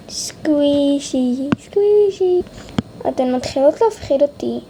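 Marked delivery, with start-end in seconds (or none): 1.6-2.4 squeaky high. Acted. Word said twice differently.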